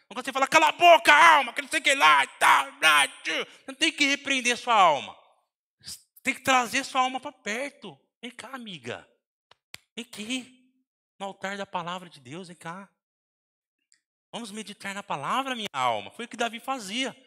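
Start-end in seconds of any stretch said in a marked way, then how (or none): 0.5-3.3 shouted rebuke, much louder and higher; mock scolding of your own soul; angry shout. Preacher shouting at his own soul, mocking. 8.2-16.1 soft, gentle coaxing, much quieter; tender invitation. Retold or imitated. Preacher calling his soul to the altar, tender.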